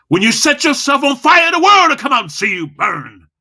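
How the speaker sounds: high, loud, driving delivery; loud and fast. Shown as a demonstration. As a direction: blast it out with impact. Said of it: "Loud and Fast".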